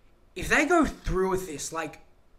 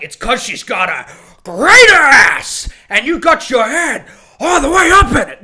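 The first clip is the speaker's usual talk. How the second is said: loud, higher, hammed-up movie rant; big mock-Pacino outburst, higher and louder; Al Pacino impression; 6 semitones higher, 14 dB louder than usual. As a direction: bellow it like a film star losing his temper. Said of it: "that scene from Heat where Al Pacino's".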